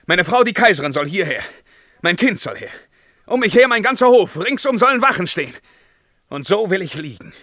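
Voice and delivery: hurried imperial outcry rising to a yell; frantic commanding shout. Acted. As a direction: shout for the whole court, fast and panicked. Emperor commanding court in panic.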